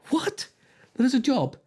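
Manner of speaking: high incredulous What? jumping up in pitch; surprised what. Real, not acted. Host reading weird job titles, high incredulous surprise. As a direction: react in disbelief. Reacting to hair boiler job.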